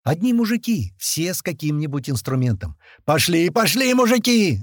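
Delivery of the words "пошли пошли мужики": high, loud shout to move; rallying yell. A narrator's reading. Rallying the workers down the corridor, yelling. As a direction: urge the men on loudly. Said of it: "орёт Туча".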